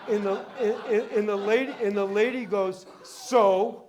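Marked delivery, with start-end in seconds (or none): 3.0-3.8 defiant retort. Retold or imitated. Lady defies the appraiser.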